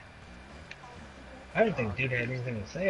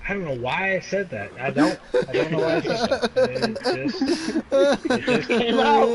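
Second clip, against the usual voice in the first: laughing while talking, pitched up; 8 semitones higher, 8 dB louder than usual. Real, not acted. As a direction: giggle through the words. Laughing at own outburst.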